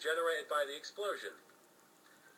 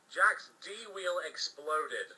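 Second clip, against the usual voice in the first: usual speaking voice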